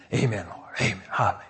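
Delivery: low, very soft mumbled praise; mock sleepy amens. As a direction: murmur agreement as if half asleep. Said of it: "And you're shouting".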